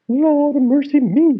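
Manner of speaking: put-on high, loud old woman's exclamation; mother's cry. A narrator's reading. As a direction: squawk it out like a startled old mother. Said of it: "she says".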